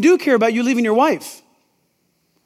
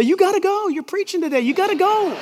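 higher, insistent wife voice; wife nagging; 6 semitones higher than usual. Retold or imitated. Wife urging the preacher to go preach, mildly voiced. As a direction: voice the wife pushing him out the door. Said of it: "Lisa will say".

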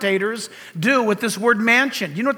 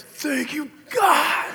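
overwhelmed thanks; 3 semitones higher, 4 dB quieter, much slower than usual. Retold or imitated. Grandpa thanks God for an unasked kiss, overwhelmed. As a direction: re-enact awed gratitude, breaking out on the last word. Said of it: "I just went like this".

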